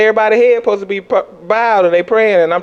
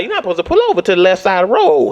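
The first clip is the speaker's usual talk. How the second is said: normal talking voice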